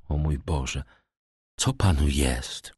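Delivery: lightly hushed, hesitant concern; timid question. A narrator's reading. Dantes asking the stricken prosecutor what is wrong, mildly timid. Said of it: "spytał nieśmiało Dantes".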